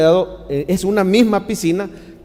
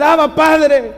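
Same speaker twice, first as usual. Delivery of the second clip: clamor a Dios; 9 semitones higher, 4 dB louder, much slower than usual. Shown as a demonstration. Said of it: "usted le puede decir".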